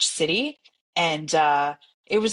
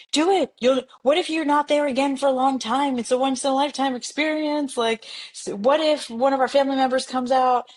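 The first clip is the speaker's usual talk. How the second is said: a hammed-up eager dad, much higher; dad insisting; 8 semitones higher than usual. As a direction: push her like a keen father. Father insisting she knock.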